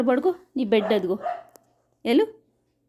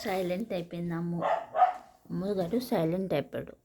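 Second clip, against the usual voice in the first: hushed; 5 semitones lower, 8 dB quieter than usual. Real, not acted. Pet fell silent, speaker hushes.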